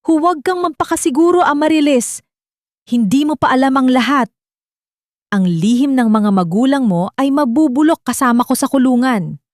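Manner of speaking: put-on venomous old woman's furious warning; bitter and angry. A narrator's reading. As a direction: hurl a parting curse as you're dragged away. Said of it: "lumingon ito ng may pait at galit".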